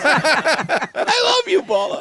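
drawn-out tender gush; slow, high, doting. Pretend. Mock tender declaration.

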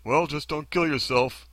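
glib version. Shown as a demonstration. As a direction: parody how hollow the advice sounds. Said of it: "how that sounds to us".

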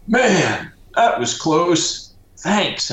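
the narrator mimics his father thanking him in a high put-on voice; dad's voice. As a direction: play the relieved dad, high and light. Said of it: "And my dad's like".

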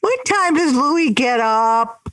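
high, slow imitation of a pupil; slowed-down kiddie voice, amused; little kid's question. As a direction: ask it like a cute small child. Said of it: "Those little voices were so cute".

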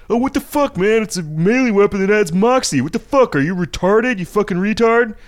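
high, loud, sneering mock rant; a scoffing high-pitched parody of angry players; mocking outraged gamer. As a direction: ham up an indignant forum jerk. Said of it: "Everybody's like".

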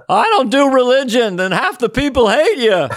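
high-pitched mock comedian voice; hammed-up observational complaint; Seinfeld. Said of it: "What does Seinfeld say about Jesus?".

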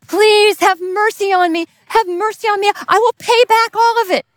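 high, pleading voice on his knees; begging servant. Acted. Servant begging the king, high and pleading. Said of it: "begs the king".

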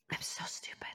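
whispered under her breath; a whispered aside, ashamed; self-reproach. Real, not acted. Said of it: "self-reproach whispered".

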